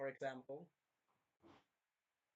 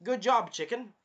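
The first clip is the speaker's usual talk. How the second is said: high, louder "Good job" with a lifted "chicken"; cheery praise; 5 semitones higher, 5 dB louder than usual. Shown as a demonstration. Cheering the queen chicken on, high and louder.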